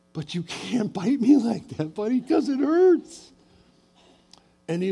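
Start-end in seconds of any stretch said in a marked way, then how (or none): 0.1-3.3 put-on higher, kindly pleading with the child; gentle correction. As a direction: coax him to understand the hurt.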